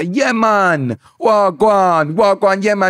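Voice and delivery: high, loud, hammed-up Jamaican; mock Jamaican greeting. Someone in a fake dreadlock hat, hammed-up Jamaican shout. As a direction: holler an over-the-top Jamaican greeting. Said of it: "and you say".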